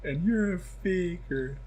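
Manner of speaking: slow mock-dramatic quote; imitating cosplayers. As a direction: ham it up like a fan reenacting. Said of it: "And they just go".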